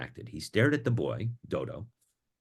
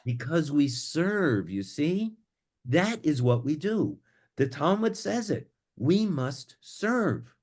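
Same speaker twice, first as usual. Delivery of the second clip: slow, higher, earnest insistence; 5 semitones higher, slower than usual. Acted. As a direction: preach the duty to serve. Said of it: "But [name] was adamant".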